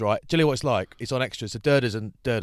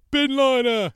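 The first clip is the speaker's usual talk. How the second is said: high, loud jeering call; high mocking shout from the crowd; mocking heckle; 7 dB louder, slower than usual. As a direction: jeer at the presenter mid-take. Mate heckling during filming.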